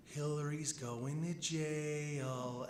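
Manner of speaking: slow, creepy half-whisper; scary whisper. Retold or imitated. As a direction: draw it out like a menacing secret. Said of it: "whispers in a really kind of, like, scary voice".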